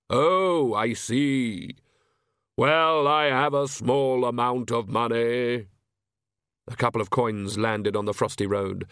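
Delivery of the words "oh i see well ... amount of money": hollow Death voice. Acted.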